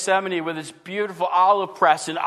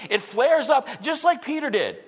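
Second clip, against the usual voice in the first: usual speaking voice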